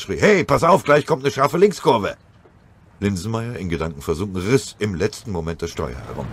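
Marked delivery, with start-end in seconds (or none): 0.2-2.1 loud, high urgent call; shouted warning. Acted. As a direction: yell the warning over the engine noise. Warning the driver of a curve. (laut gerufene Warnung)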